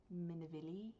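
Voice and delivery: "Mineville" as MIN-uh-vil-ee, questioning; softly tested "Mineville", said MIN-uh-vil-ee; trying a pronunciation. Shown as a demonstration. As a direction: try "Mineville" in four syllables ending in ee. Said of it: "how to pronounce this".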